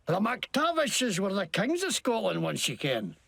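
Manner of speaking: Scottish learned from Braveheart; bad Scottish accent. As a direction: insist on a warped Scottish accent. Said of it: "What the hell kind of accent is that?".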